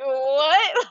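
high airy disbelief; stunned what. Retold or imitated. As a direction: squeak out a shocked what. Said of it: "I was like".

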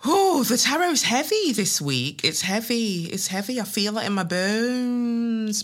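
foretell it like a spooky fortune teller; mock mystic. Host introduces this week's tarot, a hammed-up mystic.

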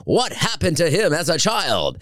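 hammed-up documentary intro; mock documentary narrator. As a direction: play it like a grave biopic voiceover.

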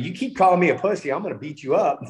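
indignant retort, pushed up in pitch; retold threat. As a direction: replay an indignant warning, half amused.

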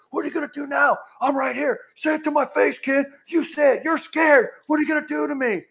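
high mock shout; imitating a furious catcher daring a heckler; acted confrontation. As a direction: challenge him to his face. Catcher's challenge to kid, retold.

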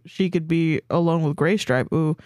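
usual speaking voice

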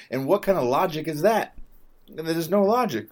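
high, loud exasperation; loud, high and fed up; outraged rant. Real, not acted. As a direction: rail against the logic.

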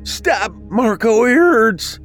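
high pleading wail; pained cry. A narrator's reading. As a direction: beg him to stop hurting you. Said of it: "he cried".

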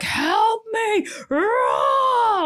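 high, strained mock cry; hiker crying for help. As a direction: imitate a man wailing on the hill. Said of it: "being like".

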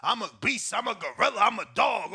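lightly imitated fighter's boasting; mock tough guy. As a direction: chant the boasts as a mock hard man. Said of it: "that tough guy energy".

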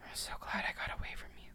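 fast, whispered contempt; bitter whisper. Acted. Woman telling stalker ex she escaped, whispered. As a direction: hiss it under your breath.

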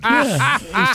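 amused laughing agreement. Real, not acted. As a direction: laugh out a high yeah. Laughing at the memory.

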